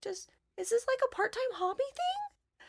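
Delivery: high, soft mock concern; condescending cutesy tone. Tech people asking if she earns money, mock condescending. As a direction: imitate patronizing acquaintances in a high, small voice. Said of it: "they get this, like, oh my gosh, that's so cute, like, tone".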